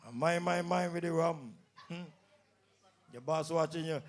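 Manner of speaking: usual speaking voice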